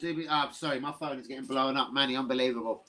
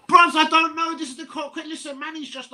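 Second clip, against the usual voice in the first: 2 a high, loud "Bruv, I don't know"; 3 burst out in disbelief; 1 exasperated outburst; 13 semitones higher, 6 dB louder than usual. Real, not acted. Bursts out after the loss.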